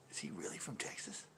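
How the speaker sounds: whispered question; hushed aside. Retold or imitated. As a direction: whisper it like gossiping customers. Retold customers gossiping about him.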